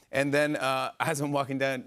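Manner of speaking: usual speaking voice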